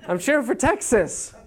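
put-on high, eager kid voice; innocent little boy. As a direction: answer like a puzzled six-year-old. Young self answering dad.

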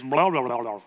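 lightly imitated toddler babble, syllables run together; toddler's jumbled speech. Dad imitating his toddler saying water bottle, jumbled babble. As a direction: mimic a little one garbling words. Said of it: "she often kind of jumbles words".

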